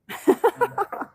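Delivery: light, high laugh; amused laugh. Real, not acted. Host fumbling some Indian words, amused giggle. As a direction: giggle softly at your own attempt.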